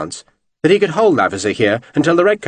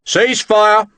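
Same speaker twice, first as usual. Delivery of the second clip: high, raised command; shouted order; 8 semitones higher than usual. A narrator's reading. Officer shouting over the battle to stop firing. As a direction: call it across the field.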